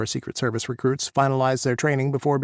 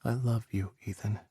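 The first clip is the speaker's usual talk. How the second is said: tender; 6 dB quieter than usual. A narrator's reading. Tender reply.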